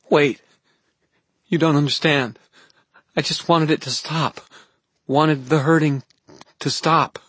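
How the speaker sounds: put-on high, frightened girl pleading; weak young voice. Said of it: "her voice weak and somehow very young".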